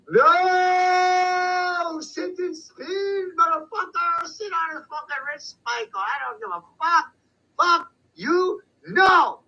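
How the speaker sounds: high yelled profane tirade, far up from his own voice; defiant outburst. Retold or imitated. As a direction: scream it at them in contempt. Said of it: "Me, I'm like".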